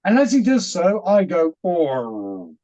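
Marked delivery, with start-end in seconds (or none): 1.6-2.5 low, soft drawn-out "oh" of relief; calming down. Retold or imitated. Calmed by a gentle hand on the arm, soothed. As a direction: let all the agitation drop away in one sound. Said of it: "and calm down immediately".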